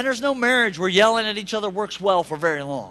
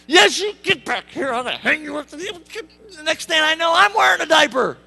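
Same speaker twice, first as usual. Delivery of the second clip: mock exasperated yell; 6 semitones higher, 8 dB louder than usual. Parent chasing toddler.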